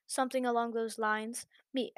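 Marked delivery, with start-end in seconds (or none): none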